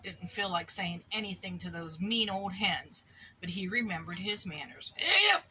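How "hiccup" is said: high, loud squeaky hiccup. Acted. Piglet hiccuping while asking to help.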